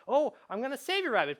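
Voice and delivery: high, loud mock-heroic promise; devoted player. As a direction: exclaim it like an earnest rescuer. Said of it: "the intended drama here is that".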